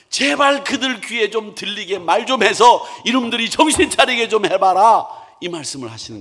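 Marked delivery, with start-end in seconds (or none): 0.1-5.1 urgent plea. Acted. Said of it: "이 말씀은".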